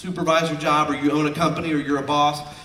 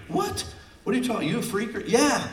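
mock disbelief; 7 semitones higher than usual. Mock bystander reacting.

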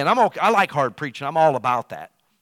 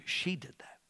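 whisper; 4 semitones higher, 10 dB quieter than usual. Real, not acted. Aside about his wife.

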